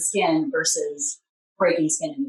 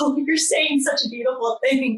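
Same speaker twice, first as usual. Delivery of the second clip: gushing; 7 semitones higher than usual. Retold or imitated. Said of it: "but I'm like".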